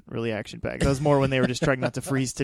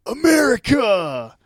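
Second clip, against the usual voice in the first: much higher and louder, slow, stretched out; a hammed-up rallying cry; mock patriotic shout; 10 semitones higher, 10 dB louder, slower than usual. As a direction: belt it like a cheering fan.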